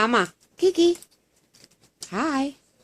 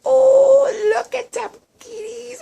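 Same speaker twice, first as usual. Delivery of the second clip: excited squeal; 11 semitones higher, 6 dB louder, slower than usual. Real, not acted. Excited owner greets her cats.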